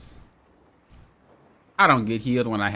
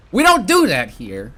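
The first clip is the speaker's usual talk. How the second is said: loud, slow joking decree; mock stern; 5 semitones higher, 7 dB louder than usual. Real, not acted. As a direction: lay down the law to chat, tongue in cheek. Mock stern rule for chat.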